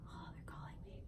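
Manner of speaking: hushed aside; whisper. Real, not acted. Vlogger noticing an incoming call mid-video, hushed whisper. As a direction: whisper it so the caller won't notice. Phone call interrupts recording.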